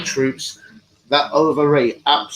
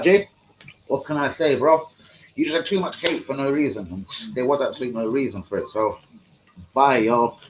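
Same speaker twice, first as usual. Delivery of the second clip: ordinary talk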